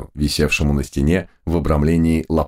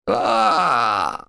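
high drawn-out scream of pain; 13 semitones higher than usual. A narrator's reading. Prisoner screaming at the shock, high scream. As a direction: shriek as if electrocuted. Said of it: "заорал я".

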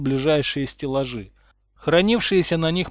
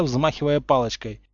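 ordinary delivery, nothing marked

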